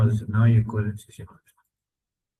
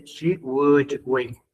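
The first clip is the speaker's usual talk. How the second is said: slow model reading; slower than usual. Shown as a demonstration. Teacher reads the English example slowly to the class. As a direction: read slowly, word by word. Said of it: "pero literalmente dice".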